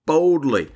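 BOLDLY pitched high, then falling; emphatic. Real, not acted. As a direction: declare BOLDLY with a high, firm fall. Emphatic exhortation to come boldly.

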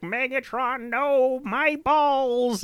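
squeaky high robot cry with a laugh; high squeaky toy robot. Retold or imitated. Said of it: "And he's like".

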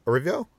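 Erivo tried as a question, "eh-RIV-ee-oh?"; unsure mispronunciation. Real, not acted. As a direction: query Erivo with an extra "ee" before the "oh". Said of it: "Is that how you".

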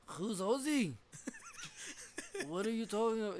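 high, put-on Irish-sounding lilt. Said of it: "I sound like Irish".

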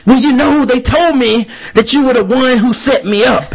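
high, hammed-up fight-scene speech; mock movie monologue. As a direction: parody a character explaining the plot mid-fight. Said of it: "somebody goes".

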